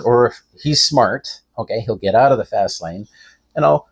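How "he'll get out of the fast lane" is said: ordinary delivery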